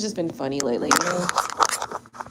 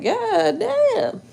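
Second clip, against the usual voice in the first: high exclaimed goddamn; hearing the girlfriend is in jail, stunned; 11 semitones higher than usual. Retold or imitated. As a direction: blurt it out in shock. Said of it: "I'm like".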